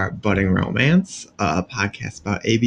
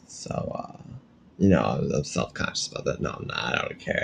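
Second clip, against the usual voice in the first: whispered aside; 8 semitones lower, 9 dB quieter than usual. Real, not acted. Joking about hairy shoulders.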